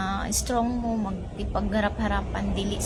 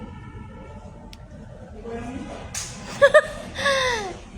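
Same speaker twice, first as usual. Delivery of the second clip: high, long shrieking laugh; 10 semitones higher than usual. Real, not acted. Laughing at a crude comment, high and shrieking.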